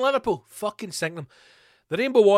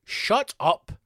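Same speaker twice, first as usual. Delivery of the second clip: ordinary talk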